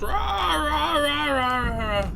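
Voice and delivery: high, slow mock scream rah rah; imitated screaming. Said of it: "The screaming has gone from scary to annoying".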